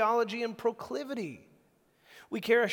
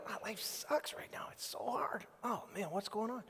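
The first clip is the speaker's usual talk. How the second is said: softer, breathy, a mopey complaint; mock gloomy moan; 7 dB quieter than usual. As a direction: whine about life, half-whispered.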